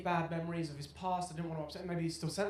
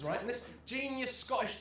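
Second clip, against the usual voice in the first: ordinary talk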